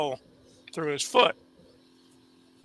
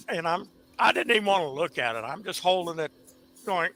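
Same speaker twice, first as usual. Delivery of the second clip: ordinary delivery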